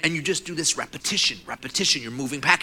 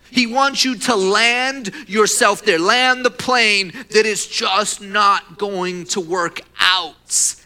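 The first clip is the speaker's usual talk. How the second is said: raised, drawn-out preaching; emphatic preaching; 5 semitones higher, 4 dB louder, much slower than usual. Real, not acted. Preacher warns church about the enemy's intent, slow and forceful. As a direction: stretch the words loudly, pitched up. Preacher drives the warning home.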